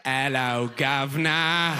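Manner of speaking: hammed-up British cockney greeting; mock British accent. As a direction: stretch it out in fake British. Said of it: "You're like".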